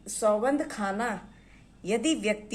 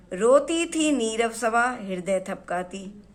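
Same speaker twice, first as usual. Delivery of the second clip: poem recitation; 5 dB louder than usual. Acted. Recites verse line.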